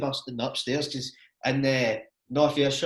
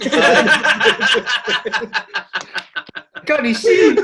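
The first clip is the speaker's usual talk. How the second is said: put-on high, loud dazed boy voice; stoned boy impression; 8 semitones higher, 9 dB louder, much slower than usual. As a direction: squeal it like a wasted kid.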